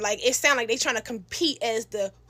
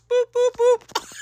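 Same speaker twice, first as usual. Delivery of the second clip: hang-up beeps; 11 semitones higher, 5 dB louder, slower than usual. Retold or imitated. Imitating phone hang-up tone.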